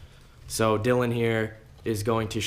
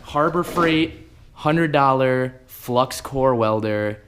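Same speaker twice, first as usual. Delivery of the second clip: slow, louder announcer read; dramatic title announcement; 4 semitones higher, 6 dB louder, slower than usual. Acted. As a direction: boom out the product name slowly. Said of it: "dramatic title announcement".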